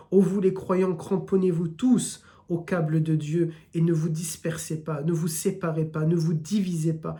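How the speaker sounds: ordinary talk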